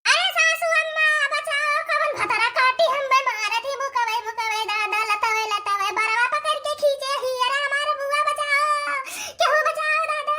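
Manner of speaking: high, shrill-free wailing cry for rescue; panicked wail while being beaten; crying for help. Acted. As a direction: scream for the mother-in-law to save you.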